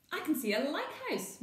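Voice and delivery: high, loud call. Acted. Mum spotting the lighthouse, calling out. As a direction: call out across the island. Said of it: "Mum calls out".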